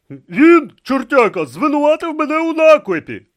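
loud, high outraged yell. Acted. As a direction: bawl the accusation. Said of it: "репетував він".

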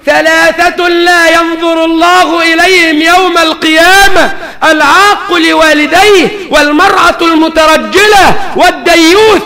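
slow, high, loud pulpit declamation; full-force preaching. Real, not acted. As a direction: proclaim it to the whole mosque. Preaching the hadith.

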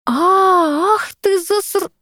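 high-pitched cry cut off mid-word; outraged cry. A narrator's reading. Owner discovering what her cat has got, outraged cry. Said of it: "услышал мой крик".

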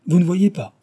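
high, loud mock-pitying question; mocking commiseration. A narrator's reading. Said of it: "avec une commisération moqueuse". (avec une commisération moqueuse)

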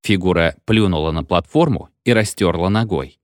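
plain reading voice

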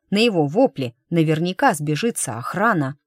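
ordinary delivery, nothing marked

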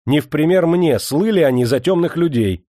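loud, heated retort; flaring up. A narrator's reading. Master rejecting comparison with his forefathers, flaring up. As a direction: fire back, stung. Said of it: "вспылил Демидов".

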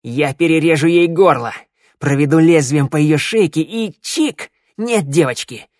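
menacing threat. A narrator's reading. Shnyryala threatens the hostage before a hidden listener, menacing. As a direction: throw the threat out across the hall.

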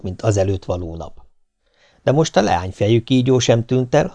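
ordinary delivery

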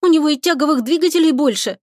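high-pitched aggrieved protest; plaintive complaint. A narrator's reading. Lika tells the captain of the rival's engines, complaining. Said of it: "пожаловалась Лика".

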